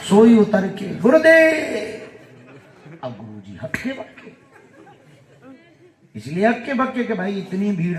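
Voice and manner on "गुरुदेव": very high, loud, drawn-out cry; devotee's calling out. Retold or imitated. Disciple rushing down to his guru, loud elated call. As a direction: hail your master across a crowd, stretching the last syllable.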